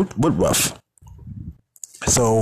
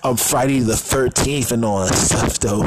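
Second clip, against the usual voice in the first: normal talking voice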